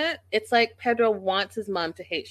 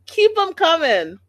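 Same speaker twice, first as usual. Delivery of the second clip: second take jumps high and loud; loud cheer; 9 semitones higher, 8 dB louder than usual. Retold or imitated. Vlogger cheering on Mama Karen, loud and high. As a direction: cheer it on, louder and higher. Repeating her own cheer, louder.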